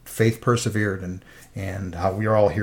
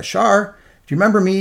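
caller's greeting; 9 semitones higher, 5 dB louder than usual. Retold or imitated. Caller greeting his wife.

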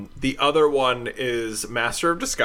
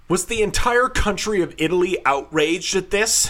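put-on outraged girlfriend; 8 semitones higher than usual.

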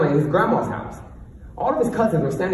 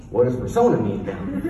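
ordinary talk, nothing marked

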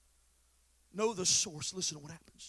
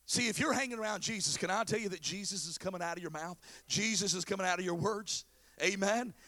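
ordinary delivery

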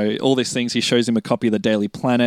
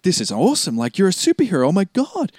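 high, gushing excitement; Freddy thrilled to learn his friend is a superhero, high and gushing; thrilled fanboy; 8 semitones higher than usual. Retold or imitated. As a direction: gush like a kid meeting his hero.

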